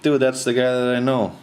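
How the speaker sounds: high, loud, amazed realization; excited recognition. Retold or imitated. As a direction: burst out as it clicks. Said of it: "and I'm like".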